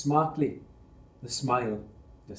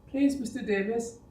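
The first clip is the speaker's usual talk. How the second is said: higher, frightened pleading; trembling plea; 10 semitones higher than usual. Acted. Scared boy pleading with the angry foreman, trembling. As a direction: beg the foreman, voice shaking with fear. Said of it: "Yayan trembled".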